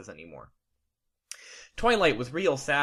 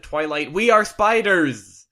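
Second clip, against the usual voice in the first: loud, high, slow exclamation; exclaiming; 4 semitones higher, 10 dB louder, slower than usual. Acted. Big Mac spider insisting to Twilight, slow loud exclamation.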